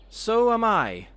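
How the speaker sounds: high little squeak of a voice. A narrator's reading. Little Johnny Bear to his parents, a high squeak. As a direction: squeak it out like a tiny bear cub. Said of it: "squeaked little Johnny Bear".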